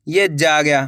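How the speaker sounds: ordinary talk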